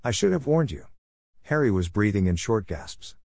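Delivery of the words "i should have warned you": lightly raised prim witch voice; a hint of a polite, proper professor; higher, prim teacherly witch asking; McGonagall voice. A narrator's reading. Narrator voicing McGonagall.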